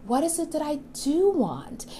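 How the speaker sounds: soft, calm question; gentle. Shown as a demonstration. Modelling the simple question for viewers, soft. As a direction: ask yourself softly and simply. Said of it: "It's that simple".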